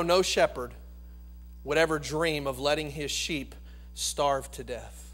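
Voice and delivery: usual speaking voice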